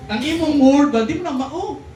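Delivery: high, loud correcting voice; hammed-up wife cutting him off; mock scolding wife. Wife correcting husband again. (nagbiaybiay nga asawa nga nagbadlong)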